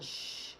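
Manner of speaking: long unvoiced sh hiss; sh sound. Shown as a demonstration. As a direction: push the air out on a steady sh. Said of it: "doing a sh sound".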